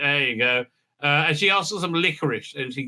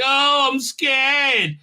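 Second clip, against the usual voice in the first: high, very slow hammed-up fright; mock scared; 9 semitones higher, slower than usual. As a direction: whimper it like a cartoon coward. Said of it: "they're like".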